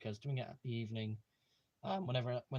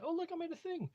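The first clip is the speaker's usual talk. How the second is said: excited squeal. Retold or imitated. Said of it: "was like".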